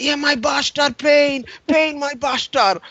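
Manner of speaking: high, moaning broken English; milk the pain like a hammed-up patient; whining injured colleague. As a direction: colleague complaining of pain while the nurse seats him. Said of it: "and he's like".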